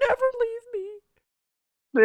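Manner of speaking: very high, slow clingy plea; pleading girl voice. Retold or imitated. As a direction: beg in a high little voice. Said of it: "she's like".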